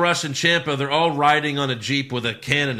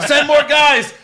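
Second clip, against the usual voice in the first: loud, high-pitched repeated order; mock frantic shout; 13 semitones higher, 10 dB louder, slower than usual. Producer demanding more brawlers, mock-panicked yell. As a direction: holler for reinforcements, hammed up. Acted rival show calling for reinforcements.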